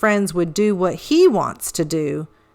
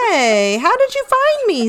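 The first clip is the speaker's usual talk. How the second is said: high-pitched, incredulous protest; astonished; 12 semitones higher than usual. A narrator's reading. Pete tagged in his best hiding place, astonished. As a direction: caught out and baffled.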